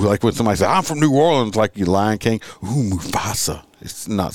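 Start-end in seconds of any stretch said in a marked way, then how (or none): 0.7-1.5 raised pitch, mock threat; half-hearted mock shout; mock tough yell. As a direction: ham up a tough-guy threat. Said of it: "he yelled that".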